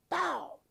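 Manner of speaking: sharp high shouted bang imitation; mimicking a rifle shot with a loud high "Bow!"; imitated gunshot. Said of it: "I heard this thing say".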